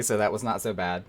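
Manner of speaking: ordinary talk, nothing marked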